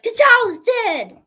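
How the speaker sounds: high, loud, slow hammed-up grief; dramatic mock announcement. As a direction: stretch it out like a tragic sister. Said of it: "she's like".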